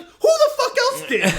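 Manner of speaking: high-pitched incredulous mock shout; retold argument at an exasperated high pitch; exasperated retort. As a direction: squeal it in comic outrage, laughter creeping in. Retelling an exasperated beer argument.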